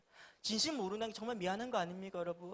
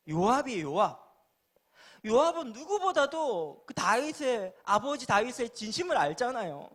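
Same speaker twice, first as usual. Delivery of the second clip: full-force preaching; 6 semitones higher, 12 dB louder than usual. Real, not acted. Preacher telling the congregation who knew David's heart, emphatic preaching. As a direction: drive each phrase out at full volume. Preacher proclaims the Bible example.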